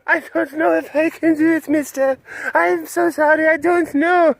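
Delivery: high, pleading put-on voice; hammed-up whimpering apology from an exhausted servant; pitiful laptop voice. Poor laptop begging its driver for mercy, pitiful high voice. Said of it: "My poor laptop is, it's like".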